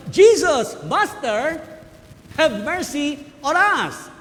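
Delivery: loud pleading cry, high; a chorus of lepers begging loudly. Retold or imitated. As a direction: call to Jesus from far off. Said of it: "crying out".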